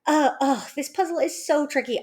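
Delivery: mildly hammed-up struggle with a task; mock frustration. Shown as a demonstration. Parent modelling puzzle frustration for child, mock exasperated. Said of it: "I'm getting really frustrated".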